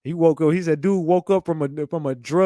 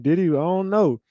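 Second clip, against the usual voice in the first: ordinary talk, nothing marked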